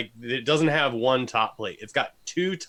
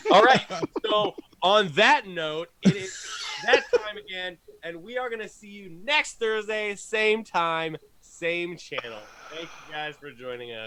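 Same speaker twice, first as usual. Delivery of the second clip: upbeat host sign-off; show outro; 6 semitones higher than usual. Acted.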